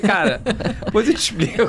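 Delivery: embarrassed laughing reply. Retold or imitated. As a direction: laugh it off sheepishly.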